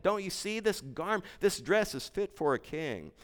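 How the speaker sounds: put-on a girl defending her precious dress, hurt and pleading; hurt little girl. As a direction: protest like a wounded child. Girl protests to the herald.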